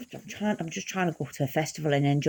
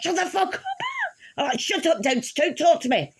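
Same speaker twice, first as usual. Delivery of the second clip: pitched-up mock shout at the punk, half laughing; high shrieking outburst; 7 semitones higher than usual. Snapping at the mocking punk in the cab, high shrill shout. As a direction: shriek it back at him, sick and panicking. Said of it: "And I'm like".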